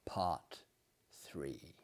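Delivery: breathy whisper, trailing off; whispered part three. Acted. Host whispering the part number to open the tale. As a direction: whisper it to set the spooky mood.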